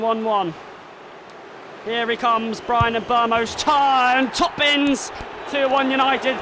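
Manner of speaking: excited play-by-play, rising with each penalty; live commentary. Acted. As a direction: call the shootout like a TV commentator.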